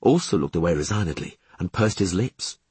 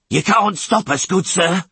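high, raised rasping voice; defiant rasp; 9 semitones higher, 4 dB louder than usual. A narrator's reading. Tallest sailor defying the witcher, high rasp. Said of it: "rasped the tallest of them".

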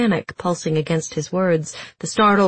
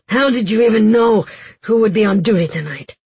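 high, loud indignant demand; bitter; 4 semitones higher, 5 dB louder than usual. A narrator's reading. Giels confronting Kaz about the roster, bitterly. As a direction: raise your voice in bitter disbelief. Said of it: "Giels said bitterly".

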